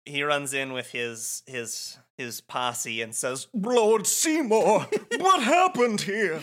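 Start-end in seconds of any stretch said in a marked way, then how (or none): 3.5-6.4 high, loud, drawn-out comic voice; hammed-up slow squawk of alarm; Trammell. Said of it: "you have the Trammell voice".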